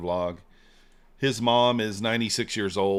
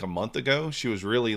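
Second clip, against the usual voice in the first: usual speaking voice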